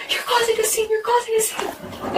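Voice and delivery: very high mimicked screech without volume; mock screaming. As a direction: imitate ex's outcry in a high voice. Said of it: "he's screaming in my face".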